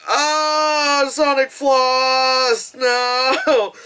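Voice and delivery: high slow mock dismay; drawn-out groan of cringe. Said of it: "I'm sitting there like".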